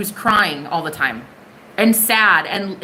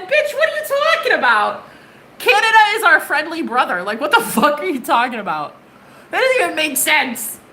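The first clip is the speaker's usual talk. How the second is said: high-pitched, hammed-up disbelief; mock incredulous; 5 semitones higher than usual. Reader replaying their reply to talk of war with Canada, incredulous. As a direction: start way up high in baffled protest, then ease down.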